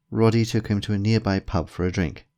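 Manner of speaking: ordinary delivery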